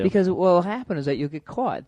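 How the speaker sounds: normal talking voice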